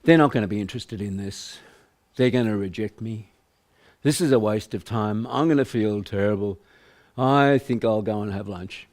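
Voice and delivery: low, sagging voice of dread; gloomy reluctant self-talk. Shown as a demonstration. Salesperson's negative self-talk about prospecting, low and gloomy. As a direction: groan it like a salesperson dreading the calls. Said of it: "Notice the tone of voice I'm using".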